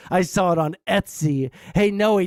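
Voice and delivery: ordinary talk, nothing marked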